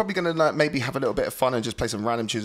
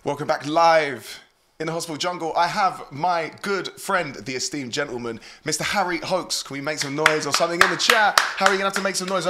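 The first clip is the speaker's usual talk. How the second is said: ordinary talk, nothing marked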